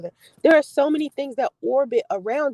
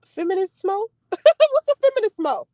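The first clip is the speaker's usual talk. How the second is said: puzzled; 8 semitones higher than usual. Real, not acted. Puzzled over odd word.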